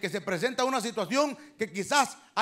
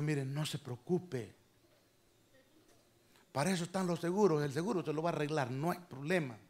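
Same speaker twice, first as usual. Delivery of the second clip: soft, quiet, soothing words to an upset woman; calm reassurance; 3 semitones lower, 7 dB quieter than usual. Retold or imitated. Pastor recalls calming the angry driver, soft and reassuring. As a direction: settle her down gently.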